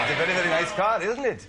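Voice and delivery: put-on lightly done fast Indian accent, laughing; a quick laughing go at an Indian accent. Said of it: "Feel free to have a go".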